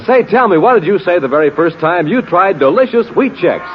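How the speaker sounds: usual speaking voice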